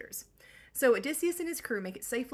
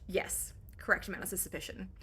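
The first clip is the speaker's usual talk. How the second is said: normal talking voice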